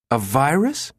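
high, rising incredulous question; scornful disbelief. A narrator's reading. Jabba mocks the director's question, scornfully incredulous. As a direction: throw it back as absurd, voice climbing. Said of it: "he burst out laughing".

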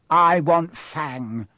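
usual speaking voice

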